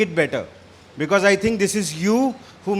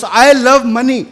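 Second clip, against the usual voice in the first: higher, loud proud declaration; raised-voice demo of shouting it; demonstrated shout; 4 semitones higher, 8 dB louder than usual. Shown as a demonstration. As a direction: call it out as if to a mall full of strangers. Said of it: "shout on the top of your voice".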